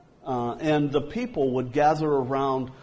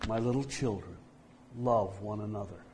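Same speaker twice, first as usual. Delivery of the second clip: soft, fading frail whisper of a dying man; a frail, failing voice, much softer; feeble old man; 5 semitones lower, 6 dB quieter, faster than usual. Retold or imitated. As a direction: barely get the words out, growing weaker. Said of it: "in a very feeble voice".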